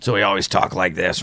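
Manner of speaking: lightly put-on tracheotomy voice; imitated voice-box speech. Imitating his first sponsor in AA, voice-box voice. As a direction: talk as if through a voice box. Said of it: "sounded like he had the box".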